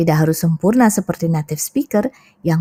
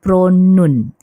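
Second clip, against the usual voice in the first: pronun said slowly and louder; spelling stressed; 4 dB louder than usual. Shown as a demonstration. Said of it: "bukan pronoun, tapi".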